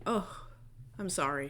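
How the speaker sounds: ordinary talk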